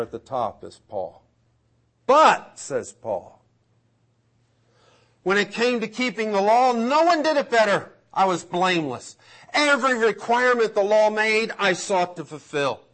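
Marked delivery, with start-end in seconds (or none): 2.1-2.6 "But" punched high and loud; sharp, raised stress on "But"; emphatic BUT. Acted. Preacher voices Paul's emphatic objection. 5.2-12.8 high, forceful preacher voicing Paul; punched, insistent boasting; Paul boasting, emphatic. Preacher voices Paul's boast.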